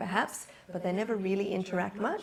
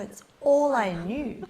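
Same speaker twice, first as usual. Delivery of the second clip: ordinary delivery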